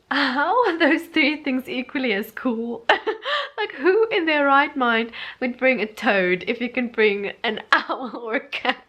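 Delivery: incredulous, breaking up with laughter; laughing disbelief. Real, not acted. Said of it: "I'm just like".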